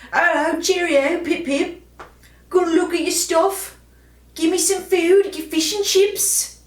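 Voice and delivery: a posh English bulldog begging for chips, mock English accent. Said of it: "It's a good English accent".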